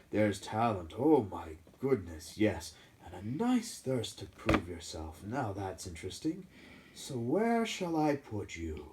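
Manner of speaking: slow, musing voice in his ear; Sorting Hat pondering inside his head; small hat voice. Acted. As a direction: weigh him up slowly and privately. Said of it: "a small voice in his ear".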